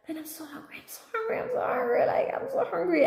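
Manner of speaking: high, plaintive repeated whine, starting soft; starving child. Retold or imitated. Speaker as a child watching the clock before dinner, hungry whine. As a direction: whine it like a famished kid, building from quiet. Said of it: "being really starving".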